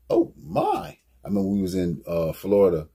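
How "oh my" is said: very high squeal of amazement; amazed oh my. Retold or imitated. People admiring hats and shoes, a squealing high oh my. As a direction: gasp at the fresh hats. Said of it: "And they like".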